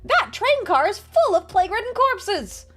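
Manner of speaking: loud, alarmed guard shouting news; a soldier shouting in alarm at a grim discovery. Acted. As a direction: yell it like the guard just saw corpses. Voicing patrolman's alarm, game dialogue.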